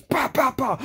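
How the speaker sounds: high-pitched mock blows; rapid high vocal punches; imitated punch sounds.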